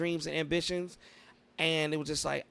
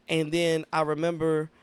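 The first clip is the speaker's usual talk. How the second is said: normal talking voice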